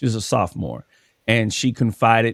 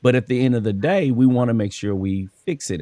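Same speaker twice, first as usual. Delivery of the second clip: ordinary talk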